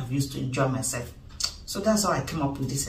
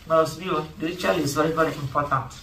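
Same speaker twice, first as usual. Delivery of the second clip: ordinary talk, nothing marked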